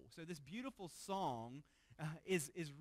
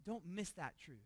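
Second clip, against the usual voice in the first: ordinary delivery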